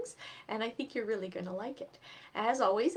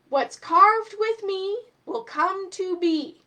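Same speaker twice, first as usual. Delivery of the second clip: slow, higher, louder spell reading; magic incantation; 9 semitones higher, 10 dB louder, slower than usual. Acted. Reading magic words on knife.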